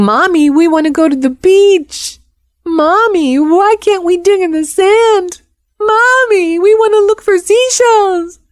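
put-on very high whiny child voice; hammed-up little kids wailing for mommy; crying kids imitated. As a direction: whine and plead like small children, stretched and climbing. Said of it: "all those kids that were crying".

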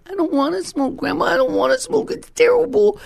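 very high mock-childish wail; pleading child. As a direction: imitate a small child begging out of it. Child pleading not to smoke.

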